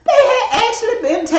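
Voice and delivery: high, fast laughing reveal; laughing punchline. Real, not acted. Mother laughing as she reveals the photos were real.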